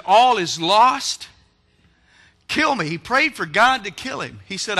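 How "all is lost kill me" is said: loud, high, slow wail; despairing cry. Acted. Elijah collapsed in the desert, loud anguished wail. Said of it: "and he cried, and he wept".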